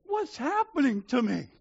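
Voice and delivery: high, loud acted sob; imitated tearful, high-pitched cry; mock sobbing. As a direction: wail it as if overcome. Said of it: "she started sobbing".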